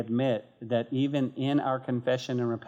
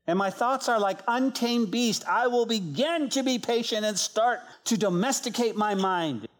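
testimony reading; 9 semitones higher than usual. Acted. Preacher reading a story to the congregation, earnest reading. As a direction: read the testimony to the congregation with feeling.

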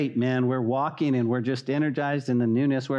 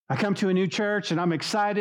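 ordinary talk

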